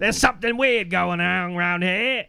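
high-pitched hammed-up British accent; British bartender. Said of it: "pretending to be the bartender".

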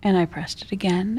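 usual speaking voice

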